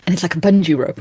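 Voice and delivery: usual speaking voice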